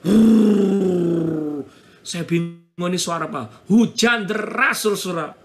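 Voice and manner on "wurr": loud, long drawn-out whoosh; sustained vocal rushing noise; imitated sudden roar. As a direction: mimic the roar of sudden heavy rain. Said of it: "Tiba-tiba ada suara kenceng".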